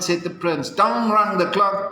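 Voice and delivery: ordinary delivery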